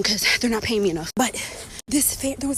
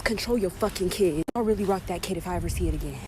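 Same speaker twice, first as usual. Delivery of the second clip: normal talking voice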